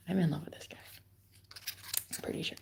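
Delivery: a soft confiding whisper. Real, not acted. Confessing to viewers she's in love, whispered. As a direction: breathe it like a secret.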